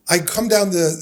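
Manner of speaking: ordinary talk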